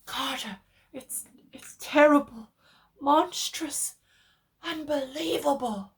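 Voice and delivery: high, loud, stammering terror; panicked cry up the wire; frenzied. A narrator's reading. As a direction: blurt it out in rising horror. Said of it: "Then came the frenzied tones again".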